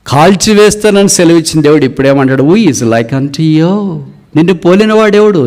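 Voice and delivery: high, loud rhetorical questions; impassioned preaching. Acted. Preacher marvels at God's changed word, high impassioned preaching. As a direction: preach it at full force, pitched high.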